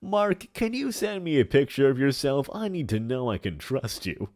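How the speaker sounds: put-on big theatrical old villain voice; hammy stage actor. Plays a hammy character in chat.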